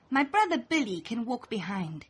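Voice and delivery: ordinary delivery, nothing marked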